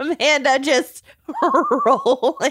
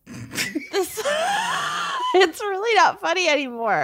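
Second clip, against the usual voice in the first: high squealing laugh breaking in; giggling too hard to talk; laughing shriek; 6 semitones higher than usual. Real, not acted. Laughing before punchline.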